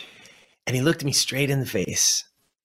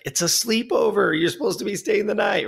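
higher-pitched mock protest from mom; incredulous mom; 10 semitones higher than usual. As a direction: imitate a put-out parent, pitched way up. Mother protests on phone.

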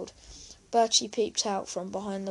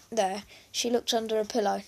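ordinary delivery

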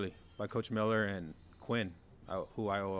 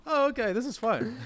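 much higher and louder, mock-bright fake cheer; mock cheerful; 8 dB louder than usual. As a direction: play it as a sarcastic, overly cheery aside. Mimicking a confused receiver's reaction.

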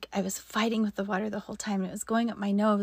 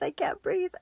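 high, panicky "I can't breathe", pitched much higher; mock panic; 11 semitones higher than usual. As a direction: squeak it out like you're going under. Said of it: "I'm just like".

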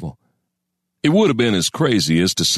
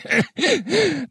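high, snorted chuckle; old man's snorting laugh. A narrator's reading. Old man scoffing at Crane's Spanish guess, snorting laugh. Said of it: "The old man snorted".

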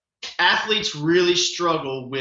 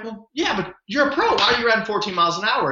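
incredulous; 6 semitones higher than usual. Retold or imitated. Incredulous at pro riding slow.

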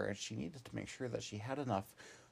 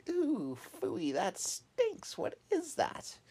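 high, squealing disgust at a bad smell; a little rabbit grossed out, voice up high; squeaky bunny disgust; 5 dB louder, much slower than usual. A narrator's reading. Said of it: "and she went".

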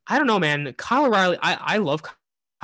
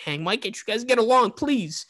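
higher-pitched, half-serious plea; mock pleading; 6 semitones higher than usual. Fan pleading with feuding wrestlers to make up, mock pleading. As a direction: beg them lightly, pitch lifted.

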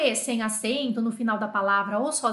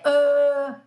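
long mute E; 3 semitones higher, 6 dB louder than usual. Shown as a demonstration. Teacher showing how the mother lengthens E, long loud "euh". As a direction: hold the French schwa out loudly. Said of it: "vai cumprir dar esse E".

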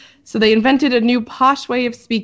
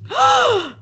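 sharp, high-pitched gasp; shocked gasp. Real, not acted. Hearing which accent came first, shocked gasp. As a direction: gasp at a surprising reveal.

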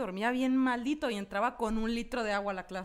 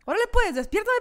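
higher, louder exasperated call; frustrated yell; 9 semitones higher, 7 dB louder than usual. Retold or imitated. Yelling at her dream sister, higher and louder in exasperation. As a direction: snap at her to wake you up.

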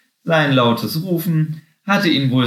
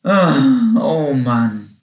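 weary sigh; 2 semitones higher, 4 dB louder, much slower than usual. A narrator's reading. Tired Happy drops into the grass, sighing wearily. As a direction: sigh it out, worn out and discouraged. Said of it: "seufzte er".

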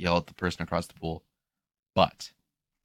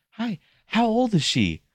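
very high, slow polite voice; sweet customer service voice; 5 dB louder, slower than usual. Retold or imitated. Lifeguard greeting a mother, high sweet voice. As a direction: put on the friendly work voice.

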